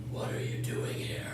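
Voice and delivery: sinister voiceless whisper. Acted. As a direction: breathe it like an evil dog. Evil dog speaks.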